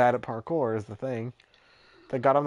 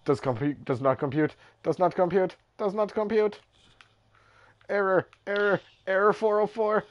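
glitching robot; 8 semitones higher than usual. Pretend. Robot malfunction bit, rising pitch.

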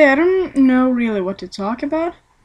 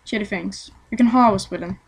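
usual speaking voice

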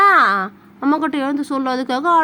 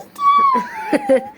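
high burst of laughter; amused laugh. Real, not acted. Mother amused by child's song. (வாய்விட்டுச் சிரிப்பது)

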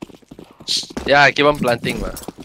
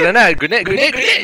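grenade warning yell; 9 semitones higher, 5 dB louder than usual. Real, not acted. Echoing grenade warning to team.

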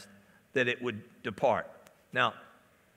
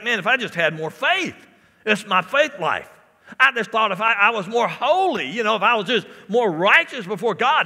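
raised, driving sermon delivery; fervent pulpit voice, higher and louder; full-force preaching; 8 semitones higher, 9 dB louder than usual. Real, not acted. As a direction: preach it loud, punching the key words.

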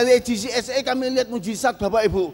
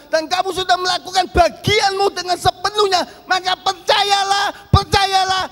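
fervent shout; 8 semitones higher, 4 dB louder than usual. Acted. Father urging his crying son, loud preaching shout. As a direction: belt it out like a pulpit appeal.

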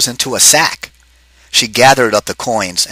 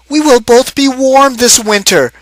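high, louder character voice; Missus O'Grady's voice; 13 semitones higher, 5 dB louder than usual. Acted. Missus O'Grady hugs her husband and speaks, high and glad. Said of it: "she said".

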